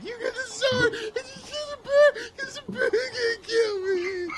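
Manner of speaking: high, loud, tearful squeal; panicked leader. Acted. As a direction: squeal orders in comic desperation. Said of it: "He's like".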